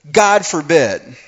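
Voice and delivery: loud, high and slow; stern rebuke. Acted. King James Paul forbidding sin, loud slow rebuke. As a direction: slam the door on the idea. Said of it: "He is upset".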